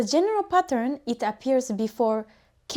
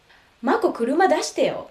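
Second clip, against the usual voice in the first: higher, quick casual request to a friend; casual native speaker; 4 semitones higher than usual. Shown as a demonstration. Teacher voicing a friend asking Mako to drive, casual. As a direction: nudge Mako for the car. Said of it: "let me demonstrate how natives actually sound".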